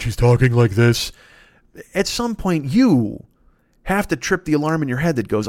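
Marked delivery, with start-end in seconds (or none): 0.0-1.1 lightly put-on deep voice; a hint of an affected deep voice; mock a put-on low voice a little; Elizabeth Holmes. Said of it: "She got a voice deeper than me".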